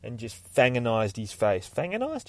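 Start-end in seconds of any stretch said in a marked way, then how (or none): 1.7-2.3 doubting own word. Real, not acted. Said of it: "Yeah, that'll do".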